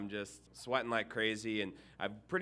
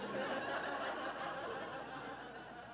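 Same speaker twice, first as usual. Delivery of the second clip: long high laughing fit; amused laugh; 13 semitones higher, 11 dB quieter than usual. Real, not acted. Minister laughing at his own story of the inspector, long and high. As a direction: break up laughing at the memory.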